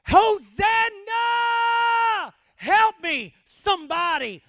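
high, full-force cry for rescue; desperate yell. Acted. Drowning person calling for rescue with last breath, desperate yell. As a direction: yell it like your last gasp before drowning. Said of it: "you yell".

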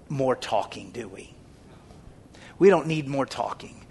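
ordinary talk

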